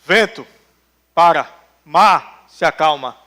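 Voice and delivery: low, slow, firm order to the storm; Jesus stilling the storm, low and firm; calm command. Retold or imitated. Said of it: "Aí ele levanta".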